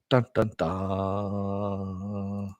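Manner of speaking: slow, soft mock "dun dun dun" sting; dramatic sting. Teasing a sci-fi show's premise, a slow soft mock dramatic sting. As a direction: hum the ominous trailer beat, drawn out.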